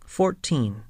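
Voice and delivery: usual speaking voice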